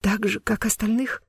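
put-on hesitant, fearful girl's voice; small, halting, scared question; frightened babble. A narrator's reading. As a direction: falter on the first word, then ask timidly. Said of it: "пролепетала она".

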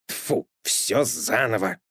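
slow grumbling cat voice; slow fed-up Matroskin; annoyed cat. A narrator's reading. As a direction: spit it out in disgust. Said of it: "отшвырнул кот карандаш".

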